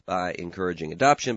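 ordinary talk

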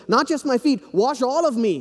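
a mock-eager disciple; excited Peter. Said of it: "he gets all excited".

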